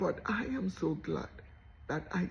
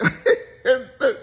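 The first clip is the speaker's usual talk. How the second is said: loud burst of laughter; 7 semitones higher, 13 dB louder than usual. Real, not acted. Laughs at her own joke.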